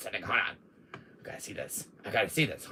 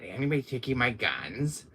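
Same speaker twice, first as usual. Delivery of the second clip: ordinary talk, nothing marked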